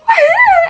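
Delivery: high sharp frightened gasp; little girl gasping at being put in timeout. Retold or imitated. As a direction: gasp loud as a shocked toddler. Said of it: "so freaking out".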